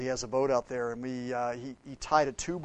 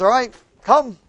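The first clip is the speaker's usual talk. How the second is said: calling come; 10 semitones higher, 15 dB louder than usual. Acted. Jesus calling Peter over.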